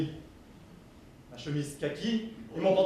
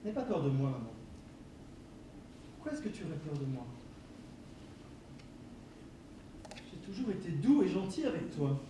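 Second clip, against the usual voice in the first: soft coaxing; 3 semitones lower, 12 dB quieter than usual. Acted. Son gently soothes frightened mother.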